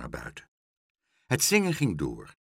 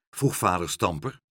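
ordinary delivery, nothing marked

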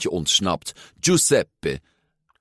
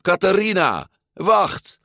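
high, urgent shout; 12 semitones higher, 4 dB louder than usual. A narrator's reading. Luca to Catharina as she swims off, shouting. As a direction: yell after someone getting away. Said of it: "Luca schreeuwde".

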